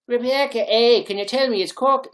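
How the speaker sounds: loud, high call to someone. Real, not acted. Streamer asking viewer Rebecca about Cork, calling out loudly. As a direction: hail them across the room.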